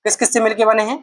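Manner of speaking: ordinary talk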